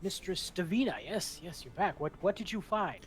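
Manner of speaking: high, loud delighted greeting; eager Duke. Acted. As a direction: welcome them back with excitement. Duke welcomes the party back.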